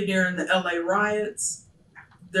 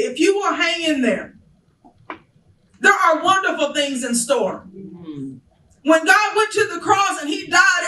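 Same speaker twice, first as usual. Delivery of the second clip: preaching at full force; 9 semitones higher than usual. Acted. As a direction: lift the church with a soaring promise.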